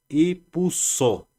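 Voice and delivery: slow, cut-off puso sound; glottal stop named. Shown as a demonstration. Teacher to students, demonstrating "puso" slowly. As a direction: say "puso" slowly with a clean break. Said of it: "Puso significa".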